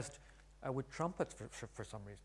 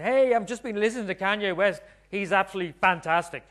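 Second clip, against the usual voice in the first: louder, gushing uncool parent; mock enthusiastic dad; 5 semitones higher, 8 dB louder than usual. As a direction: barge in gushing to embarrass them. Parent embarrassing teen in front of friends.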